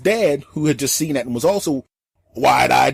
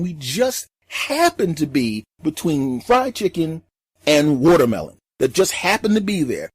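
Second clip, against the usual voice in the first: usual speaking voice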